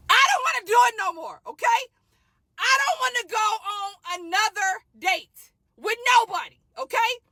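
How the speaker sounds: fed-up shouted vent; exasperated shout. Real, not acted. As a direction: shout it like you are done with dating. Exasperated rant to camera.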